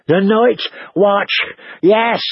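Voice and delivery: high, slow, panting plea; anxious, high-voiced captive; frightened fat man.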